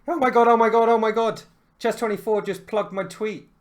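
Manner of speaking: high, loud giddy gushing; excited squeal. Retold or imitated. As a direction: gush like a thrilled fan. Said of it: "was like".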